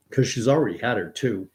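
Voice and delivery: ordinary delivery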